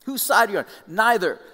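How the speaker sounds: heated exchange. Acted. Acting the exchange with God.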